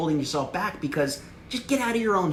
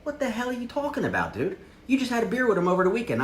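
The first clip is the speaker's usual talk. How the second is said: much higher, fast incredulous retort; skeptical friend; 9 semitones higher than usual. Retold or imitated. Buddy mocking the fake professional pitch, incredulous. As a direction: scoff at the pitch. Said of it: "They're gonna be like".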